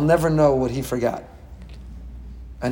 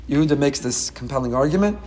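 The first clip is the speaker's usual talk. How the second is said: ordinary delivery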